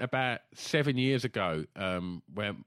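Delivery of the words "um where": ordinary talk, nothing marked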